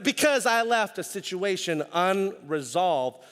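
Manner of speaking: ordinary talk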